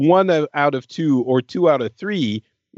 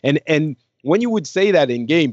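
ordinary talk, nothing marked

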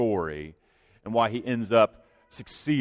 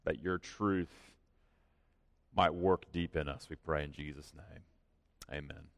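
quiet, earnest prayer fading softer; 3 semitones lower, 3 dB quieter than usual. Acted. Pastor prays for the congregation, earnest and quiet. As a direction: pray it low and sincere, sinking to a hush at the end.

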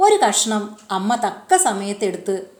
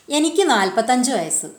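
plain reading voice

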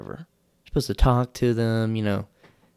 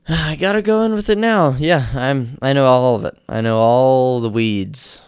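fake confidence; 3 semitones higher, 7 dB louder than usual. Telling the table he knows weed, loud mock bravado. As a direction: bluff like a know-it-all. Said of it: "I was like".

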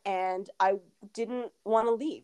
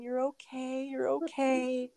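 soothing newborn; 5 semitones higher, slower than usual. Retold or imitated. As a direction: gently tell the newborn all is well. Mother soothing newborn baby.

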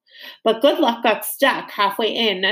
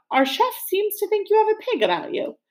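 much higher, prim and mocking; haughty butler; 11 semitones higher than usual. A narrator's reading. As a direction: look down on the chef. Butler mocks the cook.

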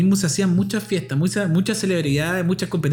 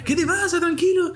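stranger soothing; 12 semitones higher than usual. Retold or imitated. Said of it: "Y me dice".

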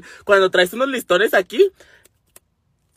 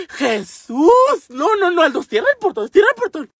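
high, panicked cry; loud, hammed-up fright; scared; 10 semitones higher, 4 dB louder than usual. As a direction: imitate a frightened girl raising the alarm. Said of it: "Bien asustada".

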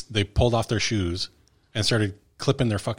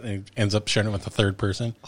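ordinary talk, nothing marked

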